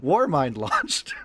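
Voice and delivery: slow, loud, high announcement; announcer voice. Pretend. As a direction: boom it out like a trailer voice. Big announcement of the release.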